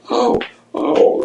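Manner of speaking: high cooing "oh, oh" of pity; mock sympathy. Passengers patting the crying man, high pitying coo. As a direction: coo at him like a soothing lady.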